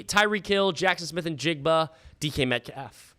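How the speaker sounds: ordinary talk, nothing marked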